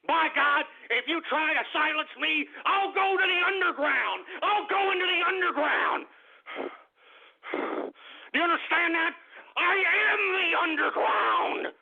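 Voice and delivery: defiant shout. Acted. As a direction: bellow it like a martyr of the airwaves. Ranting host vows defiance.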